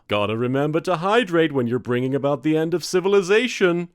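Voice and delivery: lightly voiced camp monster god; a touch of a breezy giant deity; elder god. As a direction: camp cosmic god, casually chatting.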